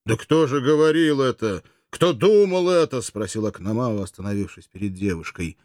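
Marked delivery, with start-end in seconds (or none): 0.0-3.0 raised, louder challenge; indignant, insistent questioning; agitated protest. A narrator's reading. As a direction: demand to know who said so. Oenomaus challenges her, agitated.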